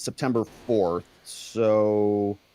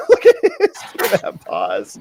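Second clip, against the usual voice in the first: laughing; 6 dB louder, slower than usual. Real, not acted. Laughing at the pause.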